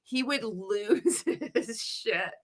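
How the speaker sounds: slow words broken by a laugh. Real, not acted. Recalling the boss's rage, laughing through the words.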